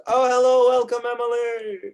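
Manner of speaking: high, loud delighted welcome; excited greeting. Real, not acted. Greeting a player who arrives.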